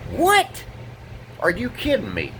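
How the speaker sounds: high, incredulous squeak, then falling; can't believe the accusation. Real, not acted. Arson accusation outrage.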